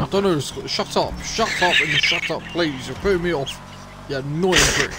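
annoyed shouting. Real, not acted. Player snapping at commentating friend, loud irritation. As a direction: yell at him to stop distracting you. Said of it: "annoying sports commentators".

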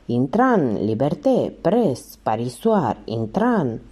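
ordinary delivery, nothing marked

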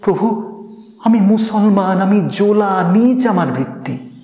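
high-pitched, agitated objection; flustered protest. A narrator's reading. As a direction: protest humbly in a hurried fluster. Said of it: "সে ব্যস্ত হয়ে বলল".

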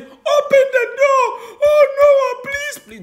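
high, crying shout for mercy; desperate pleading. Retold or imitated. Wicked people begging Noah at the closed door, crying. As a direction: beg to be let in, wailing.

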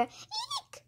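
very high, soft little squeal; squeaked. A narrator's reading. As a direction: squeak out a tiny "eek". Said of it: "squeaked Ca".

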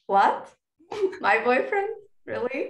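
high, incredulous questions with a laugh; mock surprise. Teacher reacting to student's slip, mock surprise. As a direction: play amused disbelief, pitch jumping up. Acted shock at student's mistake.

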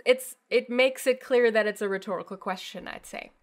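usual speaking voice

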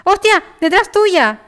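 sudden high yell of warning; alarmed shout. Real, not acted. Sudden enemy behind teammate.

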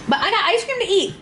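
high, quick and bright; excited. Real, not acted. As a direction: perk up about dessert. Cheers herself up about dessert.

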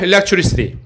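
"Electricity" modelled for repetition; pronunciation model. Shown as a demonstration. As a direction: say "Electricity" carefully for students to copy. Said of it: "발음 따라해볼까요".

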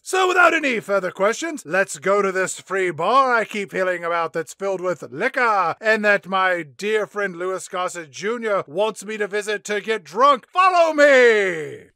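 loud, pompous hunter inviting all to the bar; hammed-up Fitzroyce. Hunter calls reporters to free bar, hammed-up boom. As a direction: boom it out like a showman host. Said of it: "Fitzroy says".

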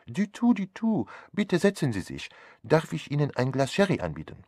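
hohe, gezierte Stimme eines höflichen Detektivs; Poirot-Stimme. Acted. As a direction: der Detektiv bittet den Gast charmant und höflich herein. Poirot figure voice. (Poirot begrüßt den Arzt: hohe, höfliche Stimme)

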